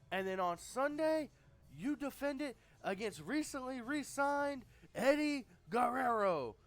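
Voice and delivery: high, loud cocky boss voice; Ric Flair impression. Flair tells Hall, Nash and Steiner who's in, loud and high. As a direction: lord it over them as the president. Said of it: "he's basically saying".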